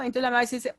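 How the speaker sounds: ordinary talk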